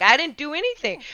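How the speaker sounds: indignant denial, retold; defensive protest. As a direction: protest your innocence, pitch jumping up. Retold protest to friend's mom.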